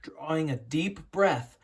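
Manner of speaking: ordinary delivery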